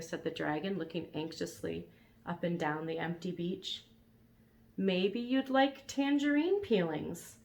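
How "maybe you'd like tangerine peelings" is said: tentative suggestion. Acted. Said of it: "suggested Elmer".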